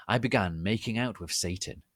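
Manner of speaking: plain reading voice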